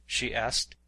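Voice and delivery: ordinary delivery, nothing marked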